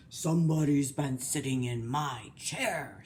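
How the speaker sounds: low, gruff growl; Papa Bear. Acted. Papa Bear finds his chair moved, gruff growl. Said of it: "his great gruff growling voice".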